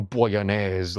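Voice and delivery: "bolognese" as Italian bo-lo-NYEZ; showy Italian accent. Shown as a demonstration. Diner mocking Italian menus, "bolognese" in Italian accent. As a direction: draw "bolognese" out with mock Italian flair. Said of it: "having to pronounce, like, some Italian shit".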